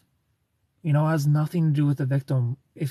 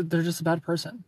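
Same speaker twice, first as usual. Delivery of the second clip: ordinary talk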